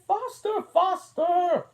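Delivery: very high, loud urging cry; the Queen shrieking at Alice to run; cried out. A narrator's reading.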